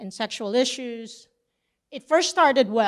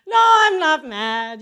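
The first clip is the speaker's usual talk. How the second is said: loud, raised, over-emphatic denial; retold unconvincing denial of anger; insisting not mad; 6 semitones higher, 9 dB louder than usual. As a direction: protest too much, pitched up and loud. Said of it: "you're not really mad, are you".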